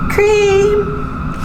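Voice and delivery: high excited shout. Acted. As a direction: shout it out with delight. Triumphant discovery after whispering.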